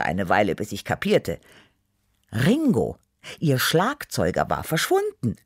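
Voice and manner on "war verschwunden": usual speaking voice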